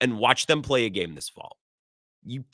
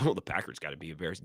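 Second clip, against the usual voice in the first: quiet, quick sneering mimic; mock the critics under your breath; 10 semitones lower, 11 dB quieter, faster than usual. Mocking critics' whiny take.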